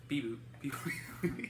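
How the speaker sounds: robotic beep boop voice; imitating Drax's beep boop; monkey noises. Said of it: "monkey noises".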